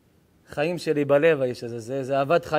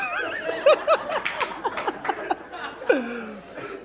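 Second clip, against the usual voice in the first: long laugh, much higher, breaking up; 4 dB quieter than usual. Real, not acted. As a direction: burst into helpless high laughter. Audience joke lands. (צחוק ארוך וגבוה)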